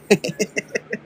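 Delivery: short high laugh; a bright amused giggle; high quick laugh. Real, not acted. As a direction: laugh at your own joke. Laughs at own joke.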